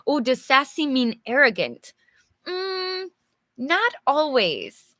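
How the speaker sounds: ordinary talk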